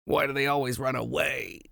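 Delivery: eager and high, then a small sad whimper; hammed-up oddball pleading; mock socially awkward rock nerd. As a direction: play the lonely weirdo showing off rocks. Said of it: "He's just gonna walk up to him".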